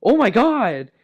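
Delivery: loud, high exclamation of shock; shocked shriek. Retold or imitated. The sister turning around, loud shocked shriek. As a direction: cry it out mortified. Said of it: "she's like".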